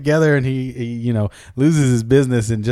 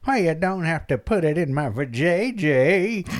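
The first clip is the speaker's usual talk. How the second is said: put-on old madam; 5 dB quieter than usual. Old madam turning away a late customer, in an old-woman voice. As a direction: creak it out like an old brothel keeper. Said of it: "she's all old".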